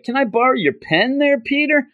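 high-pitched, put-on sweet request; Gwen. Student distracting Peter by asking for a pen, high imitated voice. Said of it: "Gwen goes over and he's like".

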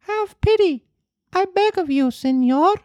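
very high, small puppet voice begging; pitiful plea. Acted. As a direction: beg like a frightened little boy. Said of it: "asked in a pitiful voice, which could scarcely be heard".

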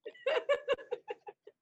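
real high-pitched giggle; laughing. Real, not acted. Laughing over boss's "how dare you", real giggling. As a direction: burst into a long, high laugh. Laughs at her own retelling.

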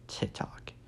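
ordinary talk, nothing marked

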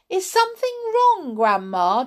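high, quick polite question; polite concern. A narrator's reading. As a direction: ask politely in a high boy's voice. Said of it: "George asked her politely".

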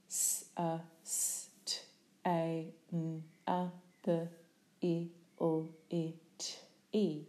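very slowly sound out "S-A-S-T-A-N-A-B-I-L-I-T-Y" one sound at a time; each sound drawn out separately; sounded out phoneme by phoneme. Shown as a demonstration.

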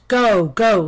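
loud lion order; angry command. Acted. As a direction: bellow it to banish the mouse. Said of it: "lifted his huge paw and pointed".

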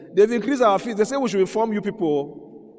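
put-on high, loud child's whine; child's complaint. Preacher voices a child reporting fee increase, whiny and loud. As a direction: whine it like a schoolchild bringing bad news.

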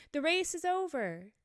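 sudden high-pitched announcement; called out. A narrator's reading. Dodo to the runners, suddenly calling out. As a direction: call it out to everyone. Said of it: "the dodo suddenly called out".

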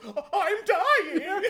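slow, very high mock death wail; shot action-movie extra. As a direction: stretch out a silly dying cry, breaking into laughter. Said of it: "shoots a guy ... he just goes".

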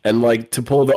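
normal talking voice

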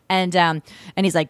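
normal talking voice

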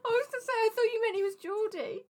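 high-pitched talk through laughter; helpless, high laughing voice. Real, not acted. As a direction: squeak it out while laughing. Laughing at the failed accent.

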